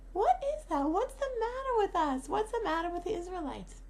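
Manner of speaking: high, loud incredulous questioning; exasperated disbelief. Retold or imitated. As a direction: demand it in acted disbelief, starting high and easing down. Quoted teacher's exasperated questions.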